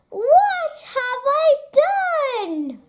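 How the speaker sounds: high, alarmed yell. Acted. Badger realising Mole is in danger, high panicked yell. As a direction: cry out in sudden panic. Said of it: "he yelled".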